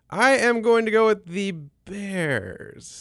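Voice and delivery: slow, drawn-out pick announcement; slow and measured, stretching the last word; deliberate announcement. Real, not acted. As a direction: spell out your pick slowly, word by word. Announces his pick with mock ceremony.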